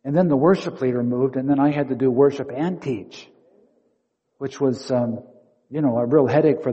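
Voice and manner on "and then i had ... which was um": normal talking voice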